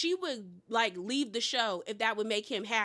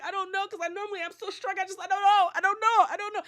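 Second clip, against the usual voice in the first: imitating crying; 7 semitones higher, 4 dB louder, faster than usual. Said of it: "she started crying again".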